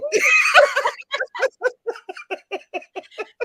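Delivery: long, high peal of laughter; real hearty laughter. Real, not acted. Mother telling her paper worker story, long real laugh.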